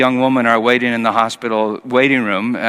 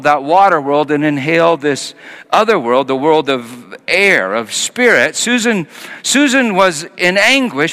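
usual speaking voice

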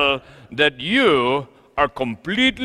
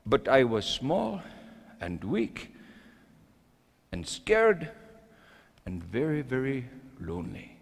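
soft, low, confessional; quiet and sad; 5 semitones lower, 10 dB quieter than usual. Acted. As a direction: let it sink into loneliness. Confession of weakness.

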